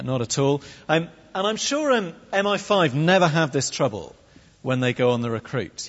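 ordinary talk, nothing marked

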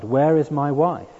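loud, anguished cry. A narrator's reading. Dying man crying out for his wife again. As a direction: call out in despair. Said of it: "Again he cried".

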